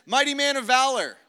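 high, loud proclamation; ringing declaration of someone's identity; angel prophesying. Retold or imitated. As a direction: proclaim it over them like an angel. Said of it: "He speaks identity. He says".

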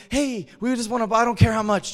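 high, loud hotel plea; retold excited customer; eager request. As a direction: burst in asking eagerly.

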